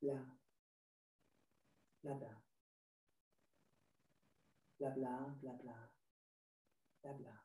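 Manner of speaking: heavy sighs, then quiet fading bla; mournful speech. Shown as a demonstration. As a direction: deliver a eulogy with only bla. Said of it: "Ich mach nochmal die Trauer".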